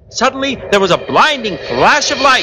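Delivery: high-pitched, thrilled storyteller; excited narration at fever pitch; dramatic story narration. A narrator's reading. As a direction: announce the sudden magical arrival.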